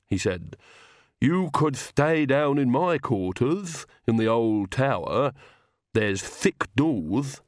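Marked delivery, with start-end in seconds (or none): 1.2-7.4 slow, deliberate servant's character voice; Igor, obliging servant. Acted. Servant offers visitors his quarters, slow obliging voice. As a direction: offer it slowly and helpfully.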